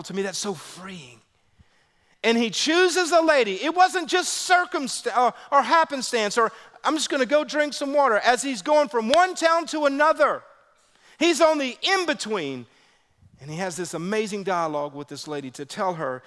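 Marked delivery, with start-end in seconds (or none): 2.2-12.7 high, loud preacher's drive; full-force preaching. Acted. Pastor preaching to the congregation, full force.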